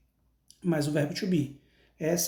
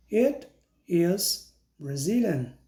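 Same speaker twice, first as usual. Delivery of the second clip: slow, clear "I am Brazilian" for pupils to repeat; slow model sentence; slower than usual. Shown as a demonstration. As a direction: say each word of "I am Brazilian" slowly so they can copy. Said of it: "Então, repita comigo". (devagar e claro, para o aluno repetir)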